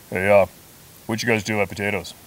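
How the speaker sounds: booming barrel-chested buddy on the phone; big barrel voice. Retold or imitated. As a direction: boom it out like a big burly guy. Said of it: "You know, big barrel voice".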